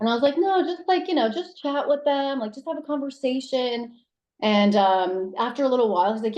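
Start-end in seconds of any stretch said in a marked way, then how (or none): 0.4-4.0 high, coaxing plea to dad; pleading. Retold or imitated. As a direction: coax him lightly, pitched up.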